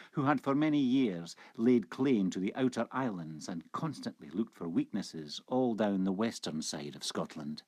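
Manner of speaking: plain reading voice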